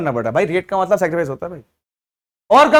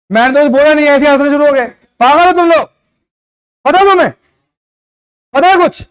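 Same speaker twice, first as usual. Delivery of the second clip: much higher and louder, fed-up telling-off of the class; real irritation, voice raised high and loud; exasperated scolding; 7 semitones higher, 7 dB louder than usual. Real, not acted. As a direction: scold the laughing students, really annoyed. Teacher scolds students for laughing.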